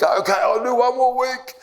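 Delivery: high, whiny grudging agreement; moaning reluctantly. Retold or imitated. Reluctant dieter agreeing to his coaches, high whiny moan. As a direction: give in with a sulky moan. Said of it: "I'd complain and moan".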